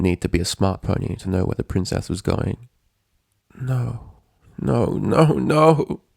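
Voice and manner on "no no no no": a desperate no that swells into a plea; rising denial. A narrator's reading. Twilight refusing the bad news, building from hushed to panicked. Twilight denying friend's fate.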